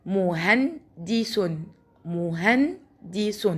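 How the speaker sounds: drawn out very slowly, pausing on the sukun; slow syllable demo. Shown as a demonstration. Teacher breaking a word into syllables for first graders. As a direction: stretch it out, stop on the silent nun. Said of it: "وقفت هنا عند حرف النون لأنه ساكن".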